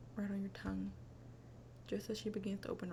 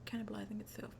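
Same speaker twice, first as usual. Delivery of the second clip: whispering; 4 dB quieter than usual. Real, not acted. Repeating gross phrase.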